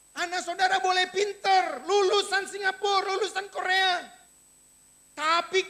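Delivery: loud, high-pitched sermon delivery; forceful preaching. Real, not acted. (khotbah berapi-api, lantang)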